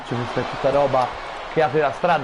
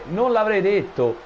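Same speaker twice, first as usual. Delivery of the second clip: ordinary delivery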